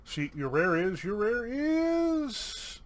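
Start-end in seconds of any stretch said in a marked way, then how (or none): 1.0-2.8 high, very drawn-out IS; suspenseful reveal. Real, not acted. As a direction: hold IS long and high like a drumroll.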